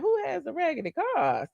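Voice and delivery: indignant. Retold or imitated. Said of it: "I'm just like".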